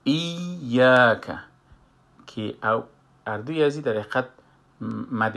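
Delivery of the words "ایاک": ایاک very slow and louder, iy-yak with a doubled y; slow recitation of ایاک from al-Fatiha; doubled ya demo. Shown as a demonstration. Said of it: "یای اول سکون است و یای دوم حرکت داره".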